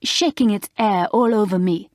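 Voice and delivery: a French lady dropping her h in air; French accent. Acted. Mademoiselle complaining of the horse, saying air for hair in a French accent. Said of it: "went on Mademoiselle".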